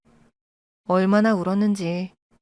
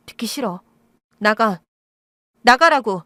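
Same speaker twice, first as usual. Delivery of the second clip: screaming; 4 dB louder than usual. A narrator's reading. Daughter screams her birth mother out of the house. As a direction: bellow 나가라고 at the top of her voice. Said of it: "나가라고 고래고래 소리를 쳤습니다".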